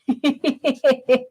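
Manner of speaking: quick, light run of giggles; short, bubbly chuckle. Real, not acted. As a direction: giggle at a funny suggestion. Amused by question about fingers.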